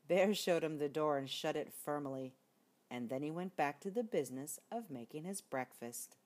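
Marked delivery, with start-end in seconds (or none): none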